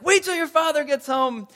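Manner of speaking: high, loud mock scolding; acted exasperated parent; parent's threat. Parent threatening child, voiced.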